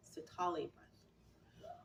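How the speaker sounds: Sitali breath whispered slowly, no voice; whispering. Shown as a demonstration. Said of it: "repeats the name, then whispers it".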